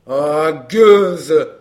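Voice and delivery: loud, low-pitched angry outburst; carried away by anger. A narrator's reading. Courrapier spotting the woman who tricked him, enraged. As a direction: hurl the insult, very loud. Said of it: "emporté par la colère".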